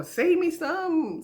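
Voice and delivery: high, slow drawn-out mock plea; pleading friend. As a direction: stretch it out like begging a favour. Friend asking for leftovers.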